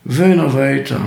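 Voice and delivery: ordinary delivery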